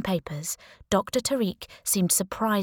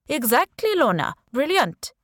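put-on Indian accent; 7 semitones higher, 5 dB louder than usual. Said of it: "he said in a strong Indian accent".

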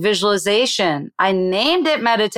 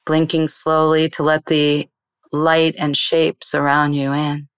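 guided meditation. Shown as a demonstration. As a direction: ease the listener into calm, one breath at a time. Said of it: "do you have a beginner exercise".

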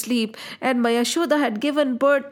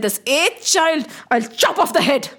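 villain's threat. Acted. Villain king threatens to kill child.

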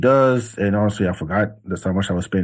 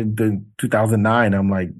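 ordinary talk

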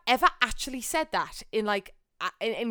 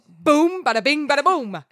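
loud, higher showman's "bada bing"; mock fanfare; 5 semitones higher, 8 dB louder than usual. Mock therapist introducing herself, loud and showy. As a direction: announce it like a cheesy showman.

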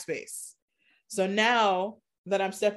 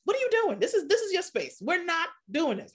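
high-pitched, incredulous telling-off of oneself; mock self-scolding; 10 semitones higher than usual. Host catching themself sounding corporate, acted high self-reproach. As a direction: pull yourself up short, pitched well above normal.

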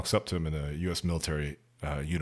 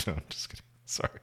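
usual speaking voice

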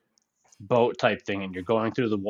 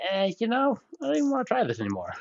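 high, fast, dismissive; bored whatever; 11 semitones higher, faster than usual. Retold or imitated. Gamer on a dull intro, high dismissive brush-off. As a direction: brush the game off, high and quick. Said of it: "And I was just like".

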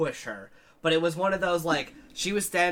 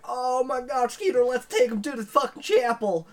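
put-on hillbilly accent; 10 semitones higher, 6 dB louder than usual. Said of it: "hillbilly accents".

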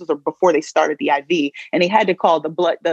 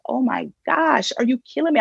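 retold bawling; 8 semitones higher than usual. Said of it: "And I am bawling like".

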